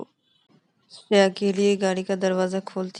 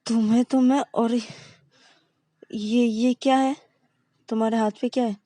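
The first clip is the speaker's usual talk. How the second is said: raised, heated confrontation; angry; 3 semitones higher, 6 dB louder than usual. A narrator's reading. Girlfriend angrily confronting her partner about the cigarette. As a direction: demand it louder and higher, furious. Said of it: "बहुत ही गुस्सा हो जाती है".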